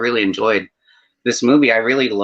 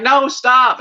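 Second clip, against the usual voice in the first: higher and louder mock command; frustrated stop; 11 semitones higher, 5 dB louder than usual. Viewer ordering the character to stop, mock exasperated. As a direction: snap at the screen to make him stop. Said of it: "I'm like".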